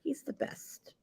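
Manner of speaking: whispered, choked up; crying. Real, not acted. Host moved by a story about a kind wrestler, whispered and teary. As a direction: barely get it out through tears. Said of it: "I'm crying".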